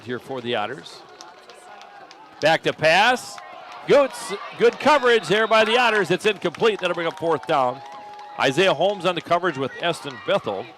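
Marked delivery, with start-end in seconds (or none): 2.4-10.7 loud, high play-by-play shout; excited shout. Real, not acted. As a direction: yell the big defensive stop. Incomplete pass called live.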